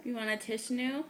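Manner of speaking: ordinary talk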